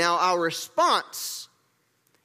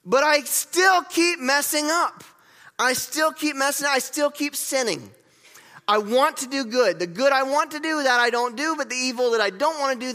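higher-pitched, frustrated confession; exasperated self-reproach; 5 semitones higher than usual. Acted. Preacher voices Paul lamenting his own sinning, exasperated. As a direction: plead in frustration at your own failings, pitched up.

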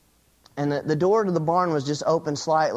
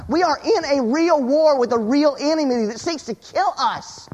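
urgent, high-pitched sermon delivery; forceful preaching; 7 semitones higher, 3 dB louder than usual. Real, not acted. Preacher warning church of a real war with Satan, forceful preaching. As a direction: drive each point home with raised, insistent voice.

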